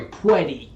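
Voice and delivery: "pwetty" with w for r; says w for r. Shown as a demonstration. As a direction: say "pwetty" like Elmer Fudd. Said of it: "Not pretty, pwetty".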